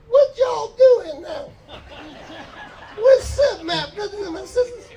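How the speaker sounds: high, loud, slow hype-man call; greeting the graduating class as a loud hype man; hyped-up greeting. Retold or imitated. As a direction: pump up the crowd like a hype man.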